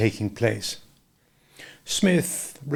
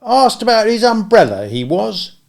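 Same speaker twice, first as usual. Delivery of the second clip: higher, cool servant voice; a touch of coldness; 10 semitones higher, 6 dB louder than usual. A narrator's reading. Attendant to Smith, with a touch of coldness. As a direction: let a little chill into the attendant's words. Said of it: "with a touch of coldness".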